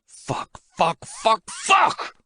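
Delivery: from a voiceless curse up to a high cry; breaking down. Acted. Man breaking down as the call cuts off. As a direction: let it crack open, whisper to wail. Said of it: "breaking down when the call ends".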